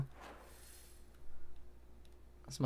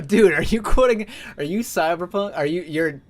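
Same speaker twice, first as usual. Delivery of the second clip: loud, high, amused surprise; catching someone quoting; surprised; 4 semitones higher, 6 dB louder than usual. Real, not acted. Recognises a Cyberpunk quote in chat.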